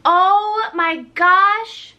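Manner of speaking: high, slow, drawn-out oh my gosh; delighted exclaim. Real, not acted. Unwrapping a gift from a viewer, slow thrilled gasp. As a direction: savour the thrill of seeing it.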